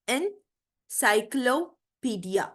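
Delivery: encyclopedia very slow, en, cyclo, pedia; split into syllables. Shown as a demonstration. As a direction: pause clearly between the parts of encyclopedia. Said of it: "three या three से ज्यादा syllables हैं".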